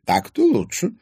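put-on high, gracious old lady's voice; condescending old lady, pleased; gracious approval. A narrator's reading. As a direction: grant it like a kindly queen. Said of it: "милостиво кивнула".

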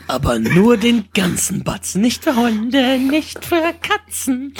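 high, slow singsong quote; quoting a catchphrase. Retold or imitated. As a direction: stretch it out like the film. Film catchphrase performed. (hohes, langsames Zitat)

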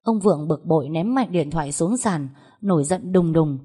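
ordinary delivery, nothing marked

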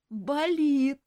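high, whiny sob of pain; whimpering elf. A narrator's reading. Elf whimpers to the nurse, sobbing. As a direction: whimper it like a sick child. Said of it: "всхлипнул эльф".

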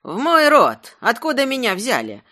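high, loud insistence; demanding. A narrator's reading. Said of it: "потребовала рогатина в ответ". (требовательно, высоким громким голосом)

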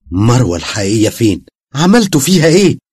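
rising, shouted demand; frightened demand. A narrator's reading. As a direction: shout at the demon to give her back. Basem confronts the demon. (صراخ خائف)

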